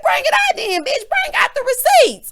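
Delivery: high, loud screeching dare; hammed-up yell daring a rival; imitated angry shout. Housewife demanding proof from her rival, mock screaming. Heavenly shouting in retold quarrel.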